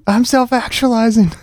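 high, teasing copy of the mantra; mocking brother. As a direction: sing the mantra back at him mockingly. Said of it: "they hear you in there saying".

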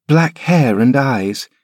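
put-on high, slow, thoughtful child voice; a boy turning the words over slowly; musing boy. Linton repeating his nurse's words, slow high musing boy. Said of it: "mused Linton".

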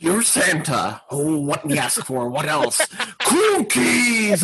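gruff, greedy cookie monster voice; Cookie Monster impression. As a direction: dictate the letter to Santa as Cookie Monster. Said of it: "give us your best Cookie Monster".

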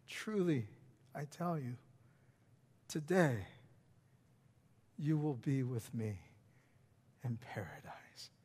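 hushed whisper, fading to breath. Retold or imitated. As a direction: whisper Jesus' promise gently. Jesus' words whispered.

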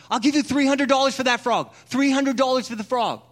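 excited punter bidding on a frog; eager bidder. Acted. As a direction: shout the offer like you must have it.

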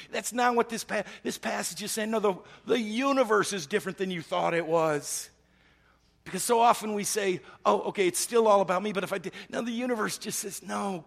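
high, loud, urgent sermon delivery; impassioned preaching. Real, not acted. Preacher overturning self-centred reading of the passage, impassioned. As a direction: drive the point home fast and forcefully. Preacher presses the point passionately.

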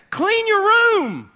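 the parent laying down the law; slow high call of clean your room; stern parent order. Acted. Parent voiced giving an order.

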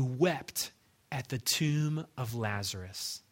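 slow, soft and gentle; tender. Retold or imitated. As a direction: comfort her slowly and softly, fading. Speaker's gentle answer to grieving woman.

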